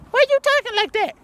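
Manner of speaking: high, loud squeaky complaint; mock objector. Voicing a critic of the preaching in a high squeak.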